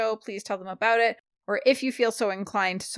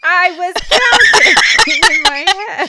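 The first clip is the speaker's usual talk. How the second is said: loud, high protest through laughter; helplessly amused self-justification; laughing defence; 8 semitones higher, 10 dB louder than usual. Real, not acted. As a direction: shout it while cracking up.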